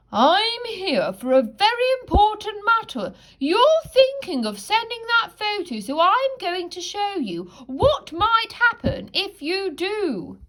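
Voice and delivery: high, earnest owl voice; old owl with an urgent message; wise old owl. Acted. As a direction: play the owl gravely important. Owl voice in story.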